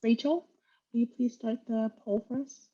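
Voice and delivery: ordinary delivery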